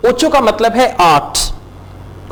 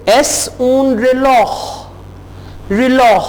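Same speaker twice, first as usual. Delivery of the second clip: slow, careful es un reloj; slow model phrase; 3 semitones higher, much slower than usual. Shown as a demonstration. Teacher slowly models es un reloj for learners. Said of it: "Y esto".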